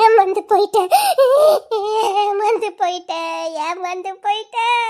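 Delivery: slow sobbed lament; tearful wail, trailing weaker; crying. Acted. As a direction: drag the words out through sobs. உட்கார்ந்து அழுதுகிட்டு இருக்கா.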